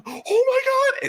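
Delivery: very high, loud squeal; high-pitched gushing disbelief; starstruck fan. Acted. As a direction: squeal slowly like an overwhelmed fan. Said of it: "and they're like".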